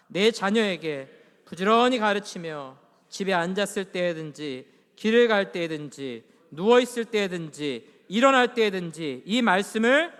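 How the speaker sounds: slow, loud congregational reading; scripture reading. Acted. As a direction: lead the room through the verse in measured phrases. Leads congregation reading a verse aloud.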